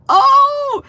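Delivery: sudden high loud "Oh!"; a startled squeal of delight; surprised yelp. Real, not acted. Surprise at complimentary comment.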